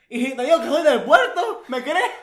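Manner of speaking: loud, high Veracruz port accent; costeño accent. Shown as a demonstration. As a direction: ham up a coastal Veracruz accent, half laughing. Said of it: "Ese es el acento costeño por excelencia".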